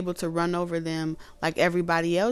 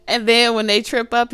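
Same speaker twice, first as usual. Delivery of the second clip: ordinary talk, nothing marked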